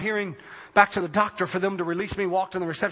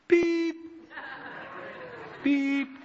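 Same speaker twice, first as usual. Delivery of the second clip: high, held electronic beep; imitated test beep; 5 semitones higher, 4 dB quieter than usual. Man hears the tone in his right ear, imitated beep. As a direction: mimic a hearing-test tone on a high note.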